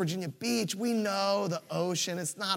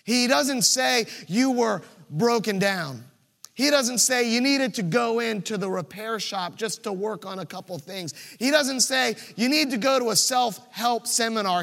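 preaching build; 4 semitones higher, 4 dB louder than usual. Real, not acted.

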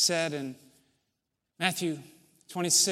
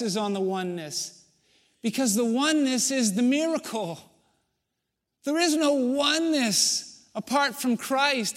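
louder, higher, building preacher's cry; fervent preaching; 6 semitones higher, 5 dB louder than usual. Acted. Pastor driving home oneness to the church, full-force preaching. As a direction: preach it out to the congregation.

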